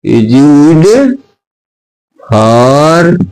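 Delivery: very slow, stretched words for copying; slow dictation. Shown as a demonstration. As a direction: read it out word by word for notes. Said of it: "teacher dictating the definition". (धीमे-धीमे लिखवाना)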